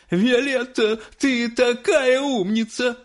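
high, drawn-out proud praise; high-pitched doting mother; gushing mother. A narrator's reading. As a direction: gush over a child in a high voice. Mother gushes over her daughter.

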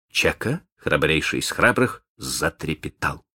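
usual speaking voice

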